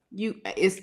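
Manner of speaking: usual speaking voice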